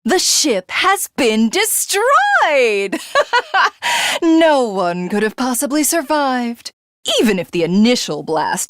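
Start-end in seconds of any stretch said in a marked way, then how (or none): 0.0-3.0 gloating. Acted. Villain gloats over victory. 3.0-4.2 high rising villain giggle; high gleeful villain laugh. Acted. Villain gloats.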